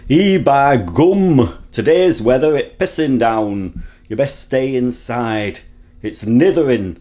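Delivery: broad put-on Yorkshire, opening drawn right out; Yorkshire accent. Alexa giving the weather in Yorkshire, put-on Yorkshire accent. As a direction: do an amateur comic Yorkshire voice. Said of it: "Alexa in Yorkshire?".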